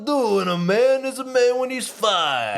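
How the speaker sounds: high, slow, puffed-up proclamation; father announcing his grandson's manhood, in a high slow mock voice; Ox King. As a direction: declare it like a proud old king. Voicing Ox King.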